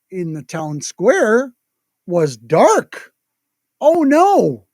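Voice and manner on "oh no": dismayed. Acted. Santa dismayed at dark town.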